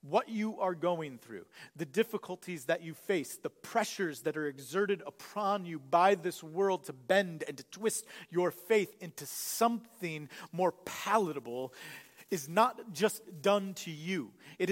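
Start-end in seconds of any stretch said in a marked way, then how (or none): none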